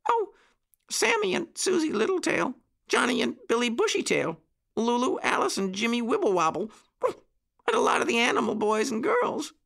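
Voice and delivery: high, eager little voice; puppy boy. A narrator's reading. Petey lists the friends for Uncle Wiggily, high puppy voice. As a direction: reel off names in a high puppy voice. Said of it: "went on Petey".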